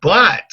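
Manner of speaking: But punched high and loud; stressed but. Shown as a demonstration. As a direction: hit the word But hard. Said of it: "he's contrasting because he just says, "But".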